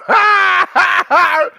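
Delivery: loud, high whooping laugh; howling laugh at the cult comment; burst of laughter. Real, not acted. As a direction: crack up hard at the line. Explodes laughing at cult remark.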